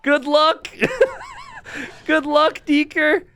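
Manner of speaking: high cackle into a mocking good luck; giggling high-pitched farewell; laughing. Real, not acted. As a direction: laugh him off the map. Caster laughing at blunder.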